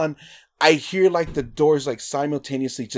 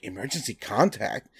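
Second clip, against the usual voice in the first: "Emergency contact?" repeated lower and softer in disbelief; incredulous echo; 4 semitones lower, 7 dB quieter than usual. Retold or imitated. Guy at sign-up echoing the form, quietly incredulous. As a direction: echo "Emergency contact?" as if you can't believe it.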